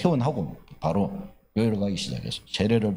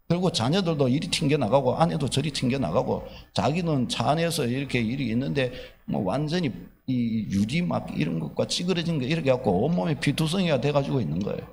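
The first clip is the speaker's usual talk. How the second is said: ordinary talk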